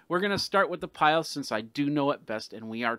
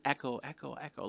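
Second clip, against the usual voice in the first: fading echo; 7 dB quieter than usual. Pretend. Mimicking an echo fading away.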